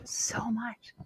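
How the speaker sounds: whispered echo, soft. Real, not acted. Coach whispering reassurance to listener, hushed. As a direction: let it fall to a breath.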